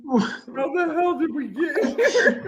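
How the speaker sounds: high, laughing disbelief. Real, not acted. Friend amazed at the tangent, laughing. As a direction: ask it while cracking up.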